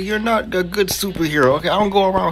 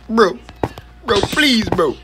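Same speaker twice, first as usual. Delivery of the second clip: panicked pleading; 5 semitones higher than usual. Real, not acted. Begging teammate for help.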